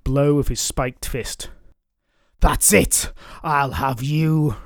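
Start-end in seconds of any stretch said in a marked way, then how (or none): 2.4-4.6 a lightly voiced roaring dragon. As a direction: dragon roars in rage. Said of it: "roared the dragon".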